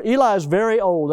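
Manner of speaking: ordinary talk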